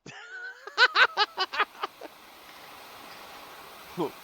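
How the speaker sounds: high cackling laugh; high laugh that tails off; laughing burst. Real, not acted. Bursts out laughing while playing.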